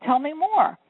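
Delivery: imagined customer, keenly interested; delighted prospect. Acted. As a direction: gush like a thrilled prospect welcoming the call. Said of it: "what would that prospect say".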